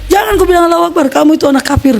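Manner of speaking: high, fast, furious rebuke from an angry father; angry father shouting. Retold or imitated. Father scolding his son for crying Allahu Akbar, fast high angry rebuke. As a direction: snap at the boy fast and high.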